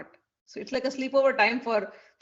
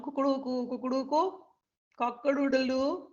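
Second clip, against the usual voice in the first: lightly crowed rooster sounds; a playful, mild crowing cockerel; rooster call imitation; 4 semitones higher than usual. Storyteller imitates the rooster still crowing, lightly crowed. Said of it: "making the sound".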